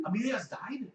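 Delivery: high-pitched disbelief; shocked question. Acted. Hedwig hearing that Amelia has died, shocked. Hedwig reacts to the death news.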